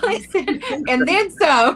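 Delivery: loud, high, through real laughter; laughing hard. Real, not acted. Host cracks up at fingers-and-toes counting joke, laughing hard. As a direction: burst out over the words, laughing.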